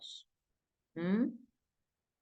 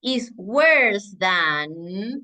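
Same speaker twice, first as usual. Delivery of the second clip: slow, careful English; teacher modelling slowly; slow model sentence; 4 dB louder, slower than usual. Shown as a demonstration. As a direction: draw out worse than for learners. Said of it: "Entonces voy a decir".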